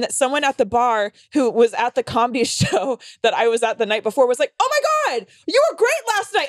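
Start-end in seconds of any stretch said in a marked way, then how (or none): 4.6-6.5 high-pitched excited squeal of praise; overexcited, way up high; gushing fan. Retold or imitated. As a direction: imitate a thrilled fan gushing. Retold gushing fan at bar.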